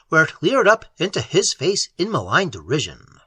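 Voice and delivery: ordinary delivery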